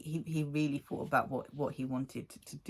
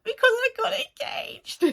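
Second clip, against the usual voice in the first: mock sobbing; 6 dB louder than usual. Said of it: "I was like".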